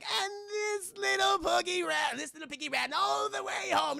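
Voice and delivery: torment a victim with a hammed-up nursery rhyme; taunting leprechaun.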